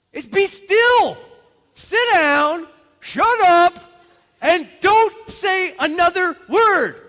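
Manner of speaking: full-force high shout, slow punched commands; exasperated parent shouting. Acted. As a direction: bellow it like a parent at a fidgety kid. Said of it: "anybody in here have a kid that moves way too much?".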